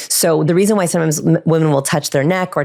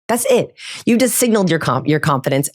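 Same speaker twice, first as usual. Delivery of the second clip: ordinary talk, nothing marked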